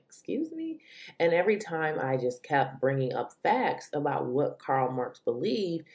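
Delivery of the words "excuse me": high, soft disbelieving squeak; incredulous excuse me. Retold or imitated. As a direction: act stunned and offended. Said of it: "and I'm like".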